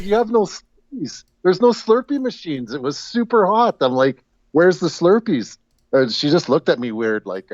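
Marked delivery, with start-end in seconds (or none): 0.0-5.6 imitating herself. Retold asking clerk for Slurpees.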